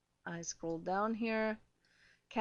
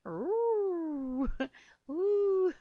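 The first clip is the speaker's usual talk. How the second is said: high, breathless ooh, as if flustered; mock swooning ooh; 9 semitones higher, 3 dB louder than usual. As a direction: play it hot and bothered. Mock swooning at a hundred men.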